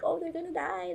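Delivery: mock panic, pitched high, as if dreading it; a pretend worried thought. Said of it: "every episode I always think".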